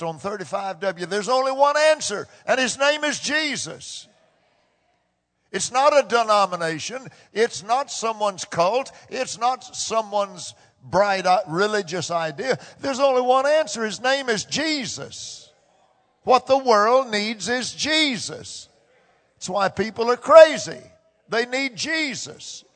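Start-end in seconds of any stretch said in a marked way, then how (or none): 1.1-4.1 rising preacher's proclamation; preaching. Acted. 12.8-22.6 rising preacher's proclamation; preaching. Acted.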